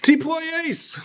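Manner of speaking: high, sassy challenge; a pointed, high-pitched demand; with attitude. Shown as a demonstration. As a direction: fling the question out with attitude. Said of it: "somebody say this with, uh, with some attitude".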